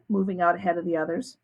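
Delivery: ordinary delivery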